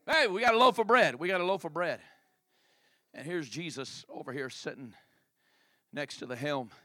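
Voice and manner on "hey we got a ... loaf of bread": louder, higher mocking call; loud mock announcement. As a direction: shout the news to mock them. Mocking disciples repeat bread.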